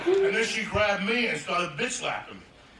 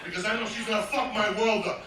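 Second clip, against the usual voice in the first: ordinary talk, nothing marked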